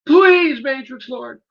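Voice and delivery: rising to a high loud begging shout; mock pleading. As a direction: beg in mock panic. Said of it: "trying to tell me".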